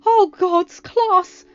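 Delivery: high, loud panicked cry; exclaimed. A narrator's reading. Mal realizing she's late for class, loud alarmed exclaim. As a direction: blurt it out in alarm. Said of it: "Mal exclaimed".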